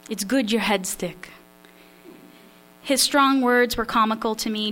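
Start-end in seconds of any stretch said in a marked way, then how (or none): 0.1-1.3 put-on Polish accent. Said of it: "attempt my father's Polish accent".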